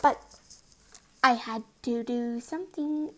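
ordinary delivery

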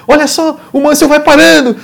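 high, excited TV race commentator; Galvão Bueno. Retold or imitated. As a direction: shout it up high like a thrilled broadcaster. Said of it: "e o roucaço".

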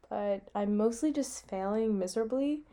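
ordinary talk

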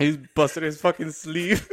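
real laughter breaking into the words; laughing. Real, not acted. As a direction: crack up while getting the words out. Both cracking up at joke.

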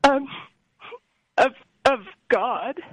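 choked up, tearful and slow; fighting back tears on the words; voice catching with grief. Real, not acted. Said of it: "I'm getting emotional".

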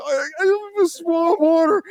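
high, slow squeal through laughing; acted breathless laughing fit; helpless tearful laughter. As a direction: squeeze the words out through laughter. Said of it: "tears streaming down my face".

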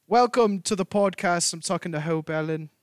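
an American trying on British; British accent attempt. Shown as a demonstration. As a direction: have a clumsy go at a British accent. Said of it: "I'll do American if you do British".

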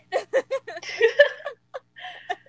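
squealing high laugh; high laughter. Real, not acted. Laughing at own quote.

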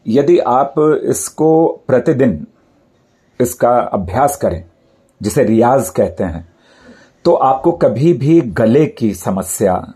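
ordinary talk

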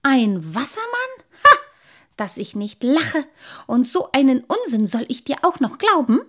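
loud, mocking dismissal; scoffing disbelief. A narrator's reading. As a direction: scoff as if at utter nonsense. Man scoffs at the claim.

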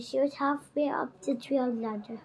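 ordinary delivery, nothing marked

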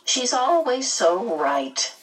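understated murmur of complaint; murmured aside. A narrator's reading. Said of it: "Missus Watson murmured".

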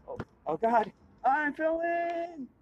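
high yelp, drawn out; screaming while falling. Acted. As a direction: cry out as you tumble in. Falls into trash can.

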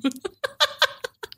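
high, light run of giggles; real laughter. Real, not acted. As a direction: laugh along with the tease. Joking admission about the agenda.

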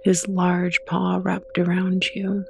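ordinary talk, nothing marked

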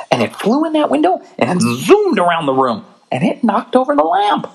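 put-on rising high, breathless kid storytelling; excited cubs. Cubs embellish the lie.

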